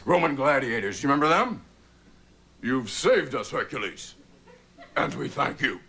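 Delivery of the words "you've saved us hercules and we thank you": slow, grand, stately movie-epic voice; epic movie hero. Retold or imitated. Grateful Romans thanking Hercules, slow and grand.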